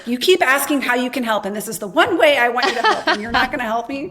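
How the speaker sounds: replaying the grudge, half-laughing at it; furious at parents. Complaining to her parents who won't co-sign, mock-furious. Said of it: "I was furious with them".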